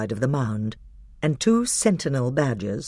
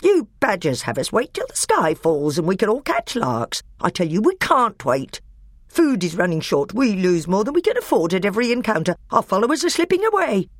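higher, louder, speeding angry rant; higher furious dwarf rant; 8 semitones higher, 5 dB louder than usual. A narrator's reading. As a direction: rail at the badger. Nick a Brick ranting.

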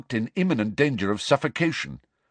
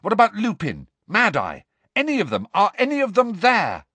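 put-on higher, urgent boy; raised urgent boy; anxious questioning; 11 semitones higher, 4 dB louder than usual. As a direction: fire off the questions.

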